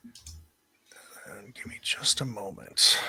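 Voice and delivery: unvoiced aside to the table; hushed aside, voice dropped to a whisper. Real, not acted. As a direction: whisper it while searching the files. GM aside while fetching files.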